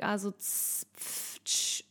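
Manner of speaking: unvoiced z, pf and tsch hisses; hissing exhale sounds. Shown as a demonstration. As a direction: hiss the air out like a pressure cooker. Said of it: "mit Soundeffekt".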